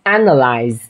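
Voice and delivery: Analyze starting on a wide "æ"; flattened E vowel. Shown as a demonstration. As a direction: smile into the first A of Analyze. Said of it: "gọi là âm E bẹp".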